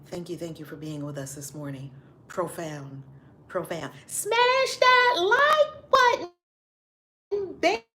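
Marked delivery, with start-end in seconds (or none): 4.1-7.8 high, slow, drawn-out hype; hype call. Acted. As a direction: sell it like a hype host. Hyping viewers to like video.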